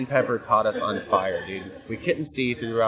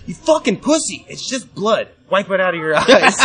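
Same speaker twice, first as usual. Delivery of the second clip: much higher, louder, jeering mock yell, cracking into laughter; mocking taunt; 8 semitones higher, 7 dB louder than usual. Devin jeering at blinded Mason mid-match, mocking yell. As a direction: taunt him like a heckling bully. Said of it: "Devin, I hear say to Mason".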